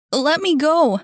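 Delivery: high, loud cry to be let go; struggling shout. Acted. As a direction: fight free with your voice. Boy struggles in grip.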